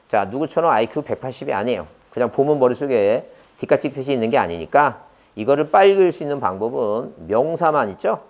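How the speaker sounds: usual speaking voice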